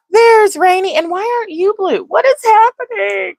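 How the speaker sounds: high, startled cries of disbelief; surprised squeal. Real, not acted. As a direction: squeal it, baffled and alarmed. Confused by her phone screen.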